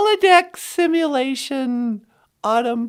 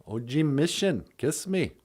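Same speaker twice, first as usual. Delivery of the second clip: much lower softer sung echo; 10 dB quieter than usual. Pretend. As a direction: drop to a deep soft echo.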